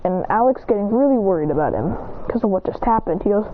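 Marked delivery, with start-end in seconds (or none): none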